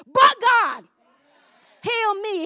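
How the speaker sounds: high, loud, triumphant declaration; shouted testimony. Acted. Church member testifying to someone with cancer, triumphant shout. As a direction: proclaim it at full voice.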